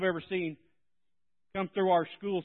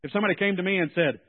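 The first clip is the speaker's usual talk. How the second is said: normal talking voice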